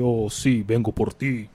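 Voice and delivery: hushed sinister Death voice; sneering panto villain. Acted. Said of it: "literal la muerte".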